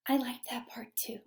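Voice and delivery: soft unvoiced aside; whisper. Real, not acted. Teacher whispers agreement to a student. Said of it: "teacher answers class softly".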